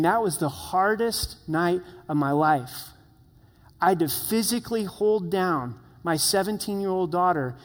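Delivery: normal talking voice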